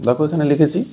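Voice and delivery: normal talking voice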